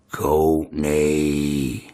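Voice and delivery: low, drawn-out hellish call. Retold or imitated. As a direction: call out slowly like something from hell. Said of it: "it just sounded like it came from hell".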